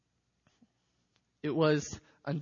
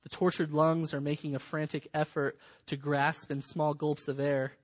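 ordinary talk, nothing marked

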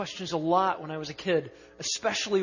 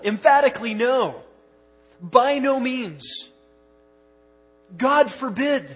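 higher and louder, slow forceful by no means; emphatic denial; 6 semitones higher, 8 dB louder than usual. Shown as a demonstration. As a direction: thunder the denial from the pulpit. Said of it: "the most emphatic way to say no".

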